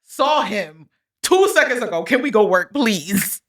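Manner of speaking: mock exasperation. Friend teasing her for clinging to her man, mock exasperated. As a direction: stretch it out in playful exasperation.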